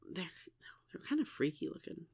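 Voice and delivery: hushed, unvoiced whisper; whispered aside. Real, not acted. Confiding that the old dolls look freaky, whispered. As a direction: whisper it like a guilty confession.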